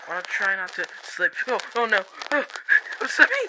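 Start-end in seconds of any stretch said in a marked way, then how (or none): 1.5-3.5 rising panic as footing goes; oh no, losing grip; panicked slipping. Acted. Slipping down into the cave.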